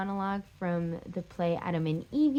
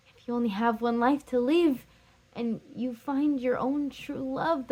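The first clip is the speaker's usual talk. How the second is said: impassioned plea, swelling higher and louder in waves; anguished Catherine; 4 semitones higher, 4 dB louder than usual. Acted. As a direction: insist you will never understand being left. Acted outburst at leaving lover.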